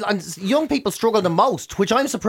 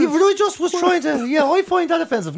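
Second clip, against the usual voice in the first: high, put-on whiny voice; hammed-up offended voice; mock offended whiner; 11 semitones higher than usual. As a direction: play a prissy offended complainer.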